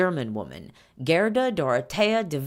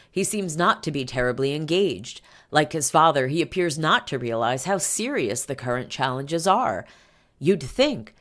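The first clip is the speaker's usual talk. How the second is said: ordinary talk, nothing marked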